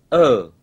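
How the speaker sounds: "ở" as a single separate vowel; isolated vowel. Shown as a demonstration. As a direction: say "ở" alone, first half of the glide. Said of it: "Thứ nhất là các em đọc âm".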